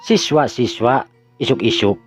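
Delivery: usual speaking voice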